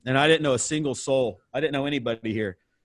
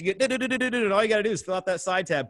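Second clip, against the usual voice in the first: door-to-door sales pitch; 5 semitones higher than usual. Retold or imitated. Young salesman pitching spa coupons at every door, fast and bright. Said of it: "I did that pitch".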